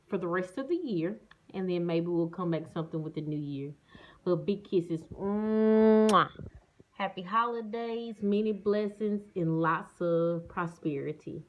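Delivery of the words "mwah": long smacking air kiss; loud kiss sound. Real, not acted. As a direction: blow a big kiss. Blowing kiss.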